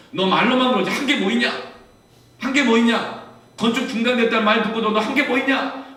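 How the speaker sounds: loud, high rebuke, hammering each question; rebuking voice. Retold or imitated. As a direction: shout down a guilty conscience. God's rebuke voiced in the story.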